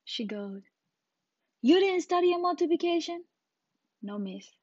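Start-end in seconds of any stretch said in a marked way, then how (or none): 1.6-3.2 high, loud scolding question; incredulous teacher. Retold or imitated. Teacher to pupil at end of day, aghast scolding. 4.0-4.5 soft, sheepish answer; meek reply. Retold or imitated. Pupil to scolding teacher, meek.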